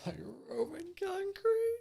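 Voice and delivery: high, quiet disbelieving echo; can't believe what he just heard; incredulous. Real, not acted. Cohost echoes the plan in high, hushed disbelief.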